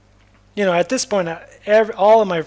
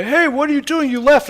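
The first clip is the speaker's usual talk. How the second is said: high, loud calling out; imitated police challenge; 10 semitones higher, 7 dB louder than usual. Storyteller voicing police who found him walking home, high loud challenge. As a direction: challenge someone who walked off, voice raised.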